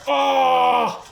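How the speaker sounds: high, held "ah" scream; loud scream. Real, not acted. Player in the fight, loud scream. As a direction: scream out one long note. Yelling in game.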